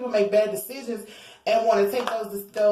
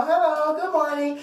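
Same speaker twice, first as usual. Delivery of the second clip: higher, chirpy hello; mock cheerful greeting; 7 semitones higher than usual. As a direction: sing out a sunny hello. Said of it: "You know".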